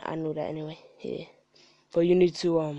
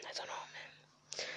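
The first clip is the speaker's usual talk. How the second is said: hushed; 20 dB quieter, slower than usual. Real, not acted. Trailing off, overwhelmed by it.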